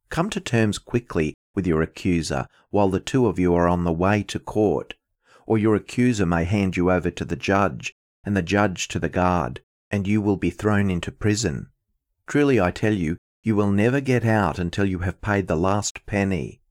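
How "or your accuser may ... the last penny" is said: normal talking voice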